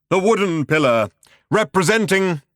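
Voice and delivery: thunderous. A narrator's reading. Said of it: "the thunderous voice continued".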